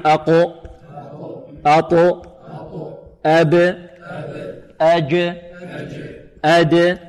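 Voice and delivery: short syllables أب، أج، أد, final consonant bouncing; qalqalah drill. Shown as a demonstration. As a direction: bounce each stop in أقو، أطو، أب for repeating. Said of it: "هكذا انطقوا معي". (تلقين القلقلة بنبرة مرتدة في أب، أج، أد)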